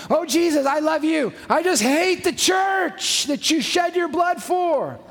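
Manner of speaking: high, loud, sarcastic put-on voice; mock a self-righteous believer, shouting the last line slowly; mocking churchgoer.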